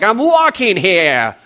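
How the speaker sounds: loud, high, drawn-out New York holler; New Yorker. Acted. Jesus cut off by a camel in traffic, mock New York shout. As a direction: yell it like an indignant New Yorker. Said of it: "Jesus is a New Yorker in my mind".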